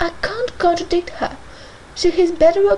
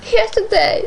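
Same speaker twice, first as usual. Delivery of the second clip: sobbed; 7 semitones higher, 4 dB louder, slower than usual. A narrator's reading. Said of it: "sobbed Isabella".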